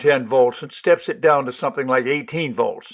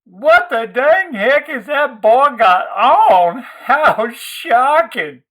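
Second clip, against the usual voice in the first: put-on high, loud, very slow screech in a phony Southern accent; screeching Southern belle; 11 semitones higher, 7 dB louder, much slower than usual. Gwendy mocking Lloyd down the school hall, shrill fake Southern screech. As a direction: shriek it like a scandalized church lady. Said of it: "her phony Southern accent peeling the paint".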